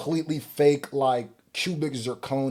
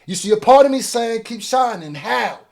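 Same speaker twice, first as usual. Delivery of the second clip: impassioned rant; 7 semitones higher, 5 dB louder than usual. Real, not acted. Ranting about blood diamonds.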